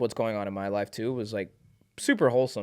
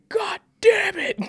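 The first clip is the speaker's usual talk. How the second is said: high, slow, hammed-up groan of frustration; exaggerated mock exasperation; 7 dB louder, slower than usual. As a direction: act out a producer fed up with the snare. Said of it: "And he's just like".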